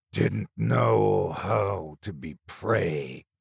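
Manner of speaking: slow hissing creature; a monster's drawn-out hiss. A narrator's reading. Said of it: "the thing hissed".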